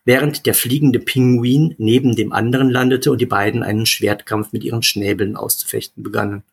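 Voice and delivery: plain reading voice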